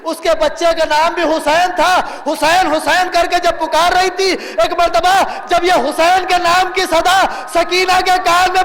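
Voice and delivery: full-force majlis oration with stretched endings; impassioned lament preaching. Real, not acted. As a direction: chant the tragedy out to the crowd.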